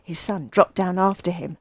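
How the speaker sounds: ordinary delivery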